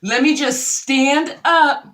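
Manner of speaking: imitating a woman announcing her exit to the room; mock public announcement. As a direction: project every word for the eavesdroppers, stretching the end. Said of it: "she makes an announcement, basically".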